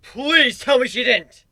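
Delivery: loud, slow, high pleading cry; a desperate plea, voice climbing and stretched; desperate begging. A narrator's reading. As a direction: beg in sheer desperation. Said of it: "I begged in desperation".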